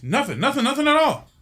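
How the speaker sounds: high-pitched, rising cover-up; mock panicked denial. Woman hiding a bird from the pilot, panicked denial. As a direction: deny it too fast and too high, hammed-up.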